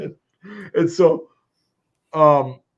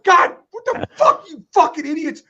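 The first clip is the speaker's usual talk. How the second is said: much higher, loud mock rant; 6 dB louder than usual. Janitor ranting about the idiots who clogged it, high loud outrage. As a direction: imitate his outraged tirade. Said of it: "just going on a fucking rant".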